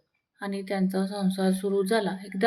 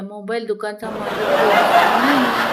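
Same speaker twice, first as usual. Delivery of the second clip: laughing punchline; 8 dB louder than usual. Acted.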